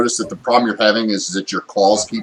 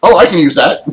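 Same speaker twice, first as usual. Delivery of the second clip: loud, higher-pitched eager discovery; sudden realization; 8 semitones higher, 10 dB louder than usual. Acted. Game master voices player spotting a dropped club, loud and eager. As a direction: pipe up as if spotting a prize. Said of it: "you're just like".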